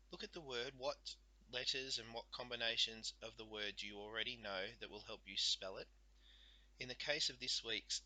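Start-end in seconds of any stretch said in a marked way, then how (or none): none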